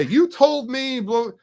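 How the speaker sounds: hammed-up little tantrum; imagined complaint to the seller, mild mock huff; mock hissy fit. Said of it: "throw a hissy fit".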